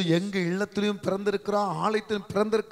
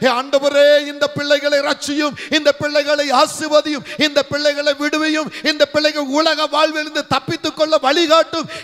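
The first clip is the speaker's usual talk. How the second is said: high, insistent pleading to God; crying out in prayer; 7 semitones higher, 5 dB louder than usual. Acted. Preacher acting out a pastor's intercession for his flock, crying out in prayer. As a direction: call out each petition in a raised, driving voice. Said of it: "என்று கதறி, கதறி, கதறி உனக்காக அழுகிறானே".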